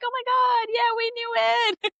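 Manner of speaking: hammed-up jubilant squeal with long-held words; mock celebrating. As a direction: imitate a whole community suddenly cheering. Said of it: "like, celebrating".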